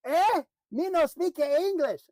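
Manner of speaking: hammed-up escalating shout. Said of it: "So you're gonna yell at me louder?".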